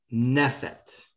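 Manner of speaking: nefet stressed on the first syllable. Shown as a demonstration. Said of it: "accent is at the beginning".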